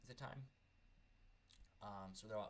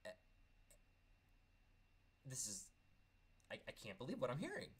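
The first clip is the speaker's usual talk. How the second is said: retold disbelief; 3 semitones higher than usual. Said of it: "I just looked at him like".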